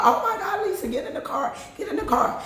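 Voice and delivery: high frantic call, laughing; panicked sister-in-law. Retold or imitated. Sister-in-law urging Lisa into the car, frantic. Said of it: "I hear my sister-in-law".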